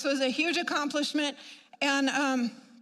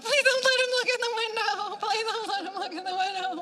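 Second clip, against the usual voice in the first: high, pleading, laughing through it; frantic plea; 9 semitones higher than usual. Retold or imitated. Praying her husband won't look in the window, high and frantic. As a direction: beg under your breath in panic.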